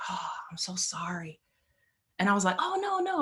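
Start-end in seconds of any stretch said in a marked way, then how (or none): 0.1-1.4 hushed, breathy sorry; whispered apology. Retold or imitated. Practice player whispering an apology to her, hushed. Guy apologizes after dropping his head. 2.6-3.2 much higher, bright no no; quick reassurance. Retold or imitated. Reassuring the embarrassed practice player, high and light.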